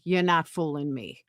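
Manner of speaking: normal talking voice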